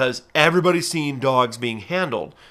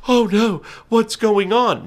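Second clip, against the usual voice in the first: higher, alarmed gasp of a fair visitor; mock alarmed onlooker; 9 semitones higher, 3 dB louder, slower than usual. Falconer imitating alarmed fair visitors watching a bird bait, mock alarm. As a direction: fret as if the bird is hurt. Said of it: "made some people".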